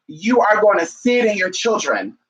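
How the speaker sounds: usual speaking voice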